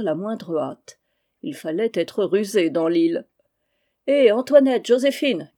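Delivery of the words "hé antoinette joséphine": loud, high summons; calling out. A narrator's reading. Joël summoning his servants to take orders, calling out. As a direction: holler the names to fetch them. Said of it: "cria Joël".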